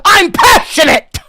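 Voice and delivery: high, very loud shout; mock passionate shout. Ginger streamer to chat, comic shout. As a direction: bellow it as a defense. Denies anger, repeats louder.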